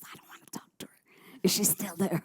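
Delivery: whispered with no voice. Retold or imitated. Mom on the phone to staff, whispering. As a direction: breathe it out secretly.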